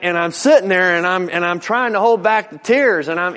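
ordinary talk

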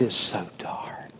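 unvoiced hushed whisper. Preacher hushes telling of the dark bedroom, whispering.

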